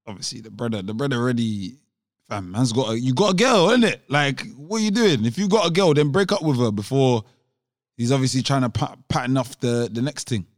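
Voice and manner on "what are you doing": ordinary talk, nothing marked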